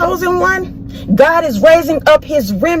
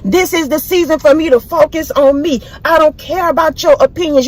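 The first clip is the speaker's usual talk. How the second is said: usual speaking voice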